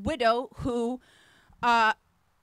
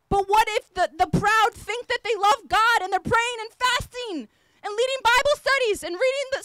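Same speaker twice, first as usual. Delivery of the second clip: rising, louder preacher's cry, higher; preaching at full force; 8 semitones higher, 3 dB louder than usual. Acted. Preacher warns the congregation, building to a shout.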